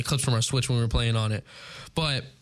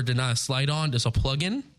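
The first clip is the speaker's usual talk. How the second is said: usual speaking voice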